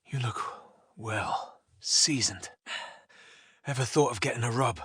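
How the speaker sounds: put-on creepy old man's low whisper; hushed, low, insinuating whisper. A narrator's reading.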